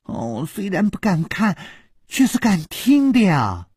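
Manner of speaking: high, playful giggly retort; giggling. A narrator's reading. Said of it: "咯咯地笑着说". (咯咯笑着，高声俏皮地说)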